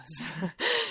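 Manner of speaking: short bright chuckle; happy laugh. Real, not acted. Author laughing about returning to Toulouse, short laugh. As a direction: laugh with delight.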